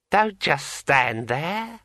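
high, slow, eerie ringing voice; like a finger round a wine glass rim; ringing metallic sword voice. A narrator's reading. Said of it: "in a voice like the sound of a finger dragged around the rim of a large empty wine".

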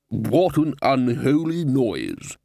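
slow, drawn-out shout of outrage, held back; a shouted complaint without full volume; mock shout. A narrator's reading. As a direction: cry out slowly, understated in loudness. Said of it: "shouted the lecturer in recent runes".

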